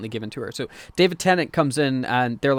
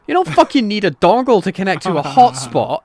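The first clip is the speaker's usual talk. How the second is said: loud, higher, incredulous outburst; exasperated rant; 6 semitones higher, 8 dB louder than usual. Real, not acted. Venting to a co-host about the hotspot, loud and exasperated. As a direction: blow up at the silly plot. Ranting about a plot hole.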